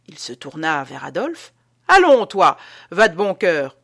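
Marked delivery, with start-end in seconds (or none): 1.9-3.8 loud, forceful command; frightening energy. A narrator's reading. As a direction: bark at him with scary energy. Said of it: "avec une énergie effrayante".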